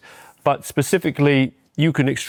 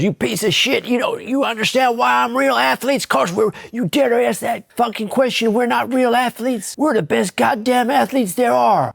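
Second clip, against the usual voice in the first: high, far-off drill-sergeant bark; Sarge yelling; 11 semitones higher than usual. Retold or imitated. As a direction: bark it like a furious wrestling coach.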